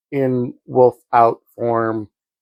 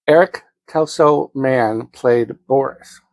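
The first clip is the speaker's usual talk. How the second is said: ordinary talk, nothing marked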